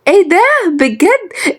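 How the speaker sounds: very high, drawn-out gasp of amazement; thrilled high-pitched little girl; amazed delight. Acted. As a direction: squeal it slowly in astonishment. Girl learns of the pregnancy.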